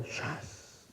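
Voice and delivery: hissed whip crack imitation; whip crack sound. Whip strike in joke.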